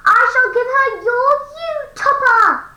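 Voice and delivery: ordinary talk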